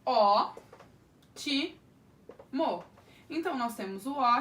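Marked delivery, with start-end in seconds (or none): 0.0-2.8 ó, ti, mo split into separate syllables with pauses. Shown as a demonstration. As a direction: break the word into its syllables. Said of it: "Por exemplo".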